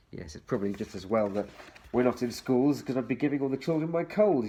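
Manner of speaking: ordinary delivery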